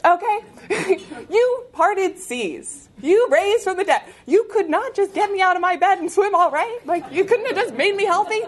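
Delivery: high, exasperated, half-laughing complaint; arguing with God, incredulous and a bit amused; exasperated plea to God. Retold or imitated. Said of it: "I was honest, and I said".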